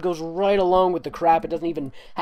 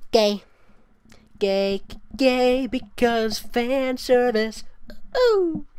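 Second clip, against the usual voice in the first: much higher, teasing sing-song; mocking sing-song; 10 semitones higher, 5 dB louder, much slower than usual. Real, not acted. Reviewer mocking the fan service, teasing sing-song. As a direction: tease it out, ending in a high ooh.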